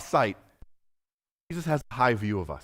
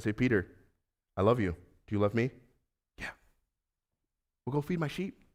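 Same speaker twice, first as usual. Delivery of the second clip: tender Jesus; 3 semitones lower, 7 dB quieter than usual. Acted. Tender Jesus-Peter exchange.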